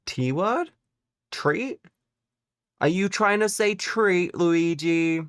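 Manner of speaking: playful mock suspicion; lightly high mock question. Real, not acted. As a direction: tease him knowingly. Teasing chatter Luigi.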